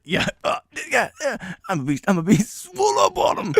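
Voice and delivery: strained beast-mode bragging climbing high; hammed-up flexing, rising to a high finish; mock alpha boasting. Worker showing off a handstand, mock macho boasting.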